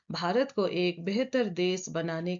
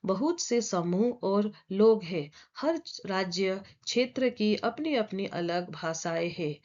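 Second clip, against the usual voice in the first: usual speaking voice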